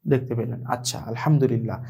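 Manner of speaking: ordinary talk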